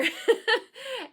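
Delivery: bright burst of laughter. Real, not acted. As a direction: laugh out loud. Nervous laugh before warning.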